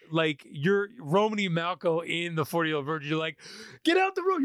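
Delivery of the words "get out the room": high mock yell at the movie; yelling at the screen. Viewer yelling at a movie screen, high mock yell. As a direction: bark it at the screen like a fan. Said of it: "You're, like, yelling at the screen".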